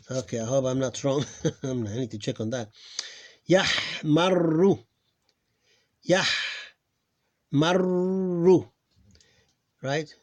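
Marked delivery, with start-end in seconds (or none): 3.5-8.7 yahmarru slow, with a long rolled r; doubled r. Shown as a demonstration. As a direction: roll and hold the r in yahmarru. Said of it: "It's pronounced like two of them".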